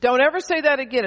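God scolding firmly; God correcting the preacher's prayer, stern. Retold or imitated. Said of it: "And God said".

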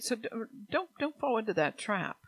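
usual speaking voice

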